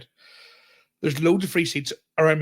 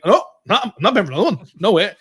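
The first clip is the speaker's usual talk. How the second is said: loud, high outraged no; retold outburst at the ticket collector, loud and indignant; indignant refusal; 6 semitones higher, 7 dB louder, faster than usual. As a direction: refuse him point-blank, voice up. Refusing to pay the ticket guy.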